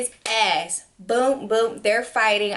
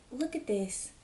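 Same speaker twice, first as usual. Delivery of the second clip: much softer, to herself; hushed aside; 12 dB quieter than usual. Retold or imitated. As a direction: mutter it so no one hears. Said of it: "I'm like".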